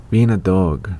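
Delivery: ordinary talk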